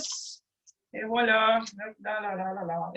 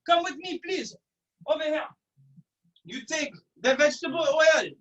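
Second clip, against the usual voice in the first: normal talking voice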